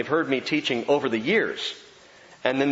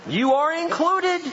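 laughing; 13 semitones higher, 3 dB louder, slower than usual. Real, not acted. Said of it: "You're not off the hook".

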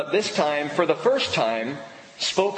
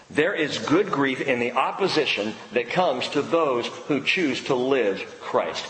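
ordinary talk

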